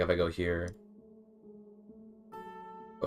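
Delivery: ordinary talk, nothing marked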